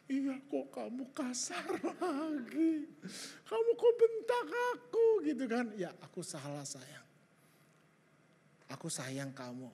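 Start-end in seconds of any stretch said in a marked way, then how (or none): 0.1-5.2 high, whining mock-tearful complaint; drawn-out, pitched-up mock crying; imitated wife. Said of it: "Langsung ngomong gini". 5.7-9.7 soft, lower tender voice; quiet, affectionate re-enacted apology; retold soothing apology.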